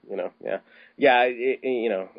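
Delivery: ordinary talk, nothing marked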